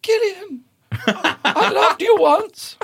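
high, slow, loud wailing ghost voice; pleading ghost of a relative; ghostly dead relative. Acted. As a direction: wail from beyond the grave.

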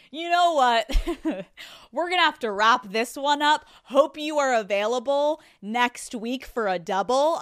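hammed-up cheerful brush-off with a laugh; mock breezy sign-off. Acting out her reply to therapist.

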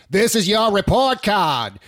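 mocking, drawn-out Boston accent; Kevin Costner's Boston accent. Friend mocking a movie line, bad Boston accent. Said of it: "Kevin Costner's Boston accent".

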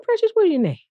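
high, country Cleveland lilt; Cleveland accent. Retold or imitated.

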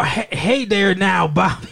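badly faked Texan drawl; bad Texan accent. Mocking movie actors' failed American accents, bad Texan accent. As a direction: put on a hammy, terrible Texan accent. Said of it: "it was kinda like a Texan".

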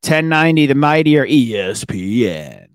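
loud hype show opener; grand entrance announcement. Real, not acted. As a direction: shout the show open like an announcer. Host opens show with hype.

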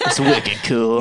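put-on slow drawn-out Boston accent; lightly imitating a Boston accent, slowly. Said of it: "he came from Boston at that moment too".

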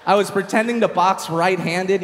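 normal talking voice

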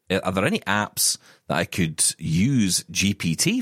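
ordinary delivery

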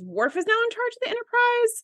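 you can't be serious; incredulous. Real, not acted. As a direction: pitch shoots way up in disbelief. Incredulous question about Worf.

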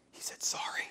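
hushed, astonished stage whisper; acted whisper of amazement; stunned whisper. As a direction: whisper it behind someone's back in disbelief. Retold managers' amazed aside.

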